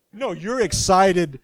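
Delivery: recalling the granddaughter's joy, loud and delighted; excited. Real, not acted. Preacher excited about granddaughter.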